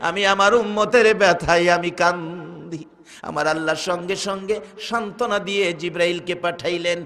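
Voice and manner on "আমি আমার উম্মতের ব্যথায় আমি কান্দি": high chanted lament, easing at phrase ends; impassioned, sing-song sermon lament; grieving Prophet, preached. Acted. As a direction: cry out to a daughter in drawn-out sorrow. Voices the weeping Prophet's answer.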